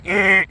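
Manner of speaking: short high "eh" like a buzzer; mouthed buzzer noise; imitated buzzer. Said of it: "he hears the big".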